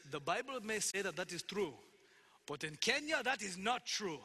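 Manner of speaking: imitated Kenyan accent, rising on the rebuttal; thick Kenyan accent. Language teacher in Kenya rebuts a verse, thick Kenyan accent. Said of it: "in this thick Kenyan accent".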